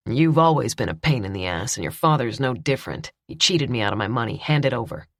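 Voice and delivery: low, sneering villain voice; menacing villain. A narrator's reading. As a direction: lean in and threaten her. Billy demands the money.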